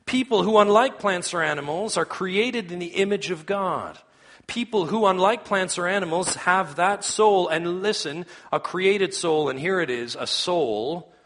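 high, loud pulpit proclamation; impassioned preaching. Real, not acted. Preacher pressing a truth on the congregation, impassioned preaching. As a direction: preach it out with force, pitched high.

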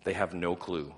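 ordinary talk, nothing marked

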